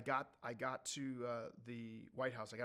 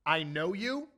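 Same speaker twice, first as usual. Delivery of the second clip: loud disbelieving echo; louder, higher, stunned; incredulous; 4 semitones higher, 11 dB louder, slower than usual. Retold or imitated. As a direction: throw the words back in disbelief. Incredulous reply to guard.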